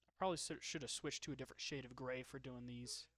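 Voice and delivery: ordinary talk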